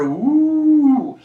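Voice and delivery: high, loud drawn-out ooh; swooping mock-impressed ooh; mock-awed ooh. Teacher hyping a three-digit number, loud mock-awed ooh.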